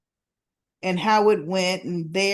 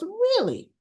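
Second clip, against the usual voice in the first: high mock surprise; lightly imitated surprised really; 12 semitones higher than usual. Former partner hearing she is moving, mock surprise.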